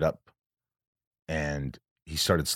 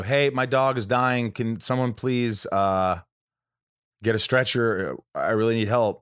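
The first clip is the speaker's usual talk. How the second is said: raised, slow, pleading call for someone to help; a dog owner begs the vet staff to help, slow raised plea; urgent plea for help; 5 semitones higher, slower than usual. Retold or imitated. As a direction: call into the clinic, begging for a stretcher. Calling out for help at hospital.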